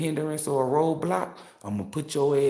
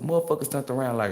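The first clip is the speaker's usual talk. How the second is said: usual speaking voice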